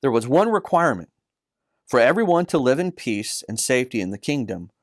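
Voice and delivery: usual speaking voice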